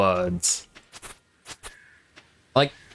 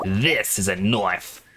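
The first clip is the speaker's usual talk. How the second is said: put-on broad Australian accent, slow and low, THIS drawn out; slow mock-Aussie boast stressing THIS; Aussie film catchphrase; 4 semitones lower, slower than usual. Streamer doing Aussie sound bites, slow broad Australian accent. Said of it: "Aussie sound bites I could say".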